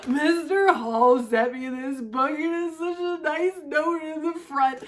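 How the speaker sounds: wife tearfully tells husband about the book, imitating crying. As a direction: blurt it through mock tears. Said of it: "I'm crying my eyes out".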